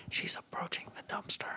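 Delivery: hushed whisper into the phone. Retold or imitated. Teammate whispering a warning over the phone. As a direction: breathe the words like a spy.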